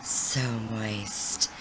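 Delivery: low, soft, creepy voice. Retold or imitated. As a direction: murmur it in a creepy way. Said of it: "it comes up with Crazy going".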